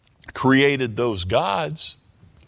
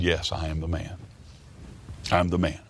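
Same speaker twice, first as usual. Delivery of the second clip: voicing David's confession, low and much softer; a broken man owning his guilt, much softer; quiet admission; 8 semitones lower, 9 dB quieter than usual. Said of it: "and David said".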